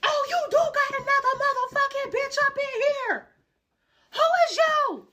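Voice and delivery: very high, loud put-on shriek; hammed-up enraged girlfriend voice. Girlfriend confronting narrator.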